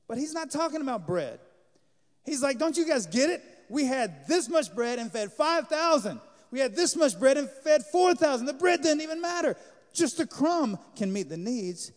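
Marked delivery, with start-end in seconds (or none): 2.6-11.9 exasperated teacher. Retold or imitated. Jesus scolding his disciples.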